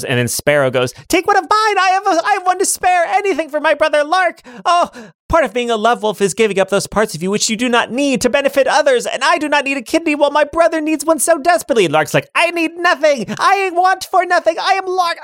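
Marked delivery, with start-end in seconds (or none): 1.1-11.8 high, hammed-up lament; melodramatic brother. Sparrow mourns his brother, high and hammed-up. 12.3-15.2 high, proud denial; proud boy voice. Acted. Lark insists to his brother, high and proud.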